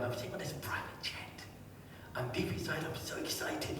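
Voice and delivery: soft, breath-only excited thought; imitating a suppressed, whispered squeal; hushed inner excitement. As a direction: whisper your secret thrill to yourself. Hiding excitement aboard private jet.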